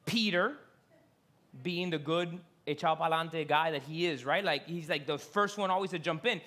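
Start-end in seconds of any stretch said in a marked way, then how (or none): none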